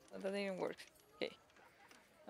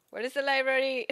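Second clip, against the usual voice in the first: loud, raised call to passers-by; mock shouting; 5 semitones higher, 7 dB louder than usual. Player asking every peasant for directions, shouting. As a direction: shout it out at strangers in the street. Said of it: "you're just shouting".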